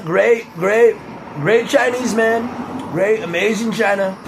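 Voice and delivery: high, boastful presidential parody; Donald Trump impression. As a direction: dish out superlatives like a rally showman. Resumes the Trump impression for neighbors.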